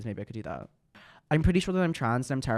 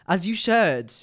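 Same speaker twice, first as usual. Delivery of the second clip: higher, louder sassy approval; sassy catchphrase; 7 semitones higher, 7 dB louder, slower than usual. Acted. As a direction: toss it off like a diva.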